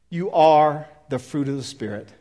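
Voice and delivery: normal talking voice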